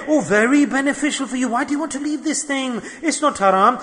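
high, coaxing tempter's voice; sugarcoated persuasion. Acted. Shaitan presents haram as halal, in a high coaxing voice. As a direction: talk them into it, pitched well up. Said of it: "he shows us and presents us the benefit".

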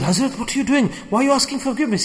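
a touch of fear, kept quiet; mildly scared. Retold or imitated. Villager questioning the Hazrat who asks his forgiveness, mildly scared. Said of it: "He became scared".

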